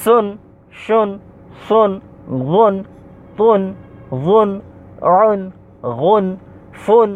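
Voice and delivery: careful Arabic letter sounds, one measured syllable at a time; pronunciation drill. Shown as a demonstration. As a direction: recite each syllable separately, from সুন to উন, with a pause after each. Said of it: "তো আমি আবার পড়তেছি". (সুন থেকে উন, থেমে থেমে স্পষ্ট উচ্চারণ)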